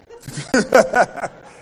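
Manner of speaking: loud chuckle after the slip; short hearty laugh. Real, not acted. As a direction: laugh at yourself. Pastor laughs again.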